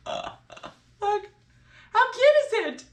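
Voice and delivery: high, cooing delight over something cute; cutesy squeal. Real, not acted. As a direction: gush like it's a puppy. Showing off a cute placemat.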